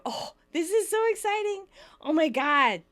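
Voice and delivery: high, thrilled gush; hammed-up giddy excitement; gasping excitement. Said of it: "she gasps".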